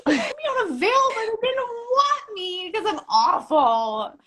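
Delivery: hammed-up high whimper of failure; mock self-pity. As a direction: whine it to him like a sulky kid. Complaining to boyfriend self-pityingly.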